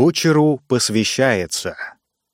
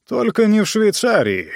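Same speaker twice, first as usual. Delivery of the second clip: smirking; 9 semitones higher than usual. Acted. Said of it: "Лицо Ранситера исказила усмешка".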